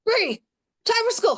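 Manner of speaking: wake-up scream. Retold or imitated. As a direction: yell it like waking a sleepy kid for school. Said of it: "scream in her face".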